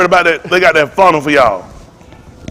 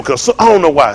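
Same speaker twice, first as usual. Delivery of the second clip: ordinary talk, nothing marked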